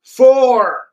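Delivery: a sudden high, loud yell of the number; mock shout. Streamer mimics fans yelling the bottle count, high loud mock shout. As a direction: bark out the count in mock excitement.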